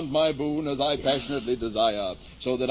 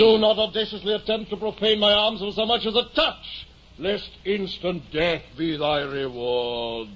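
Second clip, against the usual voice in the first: high, lofty warning; knightly challenge; 5 semitones higher than usual. Acted. Don Quixote warning the mule carrier, lofty challenge. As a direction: warn the intruder off grandly.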